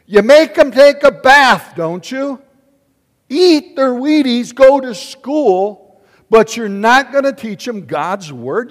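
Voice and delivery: loud, high, drawn-out preaching; pulpit-pounding shout. Real, not acted. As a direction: hammer it home to the parents.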